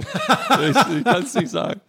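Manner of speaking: breaking up with laughter while trying to speak; real giggling through the words; laughing too hard to finish the sentence. Real, not acted. Laughing at failed pronunciation.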